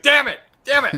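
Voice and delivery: high, loud mock exasperation; hammed-up frustration; frustrated self-scolding. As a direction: curse your own slip, loud and high. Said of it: "inflecting a little too much, and I was like".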